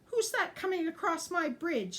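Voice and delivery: high, loud squeak of a scared little ogre; squeaky little ogre. A narrator's reading. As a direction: squeak it out, trying not to faint. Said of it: "squeaked out the little ogre".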